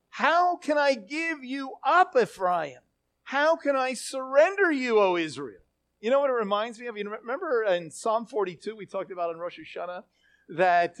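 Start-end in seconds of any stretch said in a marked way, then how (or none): 0.1-5.6 torn, pleading questions; anguished lament. Acted. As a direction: lament aloud, voice lifting then falling on the name. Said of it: "we see the angst that God has".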